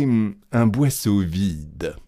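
ordinary delivery

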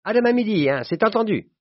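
high-pitched, hearty insistence; emphatic farewell. A narrator's reading. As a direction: press the appointment on your pal exuberantly. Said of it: "comme s'il voulait m'arracher les bras et prononça". (insistant et enjoué, voix haut perchée)

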